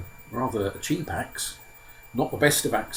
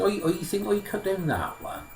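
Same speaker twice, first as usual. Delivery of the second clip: high-pitched simple lad in a put-on accent; naive Simpleton; 9 semitones higher than usual. Simpleton picks out a tree, high and simple. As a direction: pitch it up as an artless youngest son.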